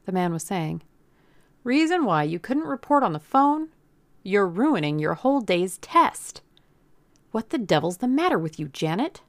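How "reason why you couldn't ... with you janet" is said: exasperated scolding. A narrator's reading. Boss scolds girl over test.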